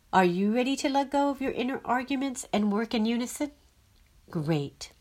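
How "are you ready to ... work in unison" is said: fast, higher, rising at the end; brisk eager question. Shown as a demonstration. Forecaster inviting listeners to let go, fast and eager. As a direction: sweep through it in one eager breath.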